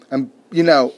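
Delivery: usual speaking voice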